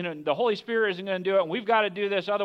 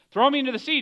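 higher, mildly angry order; angry demand; 9 semitones higher, 4 dB louder, much faster than usual. Acted. Jonah to the sailors, angrily demanding to go overboard. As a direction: demand it in a raised pitch. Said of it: "He's angry".

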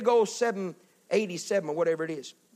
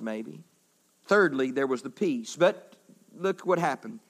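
ordinary delivery

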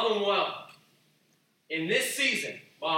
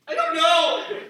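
high, loud helpless cry; mock exclaim; 12 semitones higher, 14 dB louder, slower than usual. Believer caught without an answer by his lead, loud mock cry. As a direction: throw up your hands out loud.

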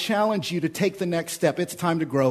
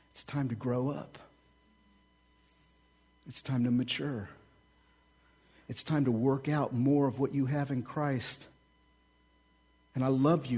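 soft, gentle voicing of Paul; quiet pastoral urging; 3 semitones lower, 6 dB quieter than usual. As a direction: drop right down and speak tenderly to them. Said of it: "a very gentle way".

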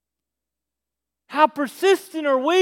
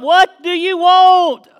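loud, raised, drawn-out irritated yell; aggravated; 4 semitones higher, 8 dB louder, slower than usual. Acted. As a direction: yell down at whoever woke you. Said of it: "He's done got him aggravated".

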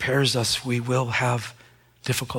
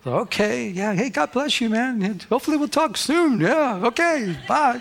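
cheery brush-off; 11 semitones higher than usual. Retold or imitated.

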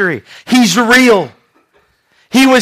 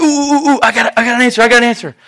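higher, excited, tumbling repeats; eager know-it-all pupil; 3 semitones higher, much faster than usual. Acted. Peter as the guy in class, bursting to answer. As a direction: burst to be picked. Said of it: "But he's the guy".

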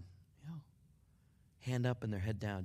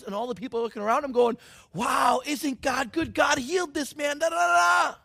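loud, high, jubilant preaching; preaching at full force; 11 semitones higher, 12 dB louder than usual. Acted. Pastor telling the crowd, at full shouting force. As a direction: shout the miracle to the rafters.

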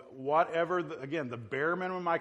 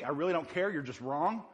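ordinary talk

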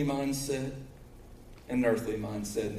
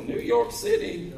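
high-pitched, drawn-out mock exclamation; slow, squeaky-high commercial parody; commercial catchphrase imitation; slower than usual. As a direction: mimic the incredulous ad catchphrase, pitched way up. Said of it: "You know that old Pace commercial".

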